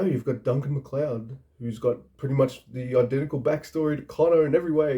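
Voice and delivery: normal talking voice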